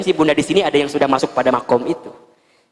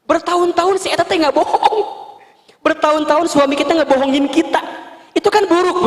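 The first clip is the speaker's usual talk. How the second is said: high whiny wife's lament; complaining wife; 10 semitones higher than usual. Retold or imitated. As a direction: whine like a hurt wife. (istri mengeluh dengan suara tinggi)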